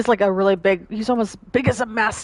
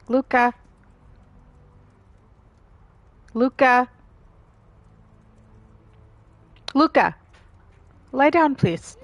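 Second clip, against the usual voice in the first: raised, higher call to a pet; calling the dog; 6 semitones higher, 3 dB louder than usual. Real, not acted. Owner calls dog from the window, raised calling voice. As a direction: call out to get the dog's attention.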